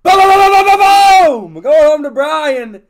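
screaming, very high and loud; hype shout. Real, not acted. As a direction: yell it like a jackpot. Big hit reveal, shouting.

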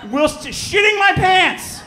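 slow, high, loud panicked yell. Retold or imitated. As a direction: shout it out like you are falling from the sky. Retelling terror of nosedive.

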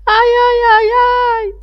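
high, loud cries of pain; ogress howling. A narrator's reading. Ogress stung by bees, howling high and loud. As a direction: yelp as the bees sting. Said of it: "hurla l'ogresse".

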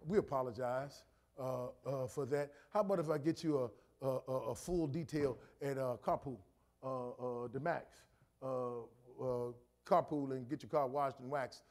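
gentle conciliatory voice; soft polite customer service voice. Retold or imitated. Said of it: "I take the low road".

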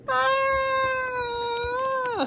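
long high-pitched scream. Pretend. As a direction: let out one sustained high "aah" scream. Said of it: "He's screaming".

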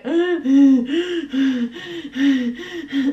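long run of exaggerated panting; mock heavy breathing. Imitating a boy laid there for no reason, heavy breathing. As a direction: imitate repeated laboured breaths, hammed up. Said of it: "just laid there for no reason and just going".

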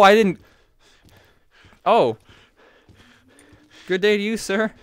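ordinary delivery

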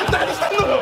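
very high laughing tsukkomi; high comic retort. Real, not acted. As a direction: shriek the punchline back. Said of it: "tsukkomi repeating". (笑いながらの高いツッコミ)

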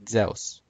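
Zeus said zeh-oos, gliding eh into oo; classical Greek pronunciation. Shown as a demonstration. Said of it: "classical Greek".

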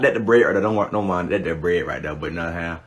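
trailing off low; fading mutter. Real, not acted. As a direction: let it fade away.

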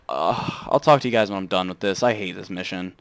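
ordinary delivery

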